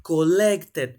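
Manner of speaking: loud "Collected" with the final -ed sounded; ed pronounced fully. Shown as a demonstration. Teacher confirming Jonathan's answer, loud and clearly sounded ed. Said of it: "Acá la pronunciación es de una E D".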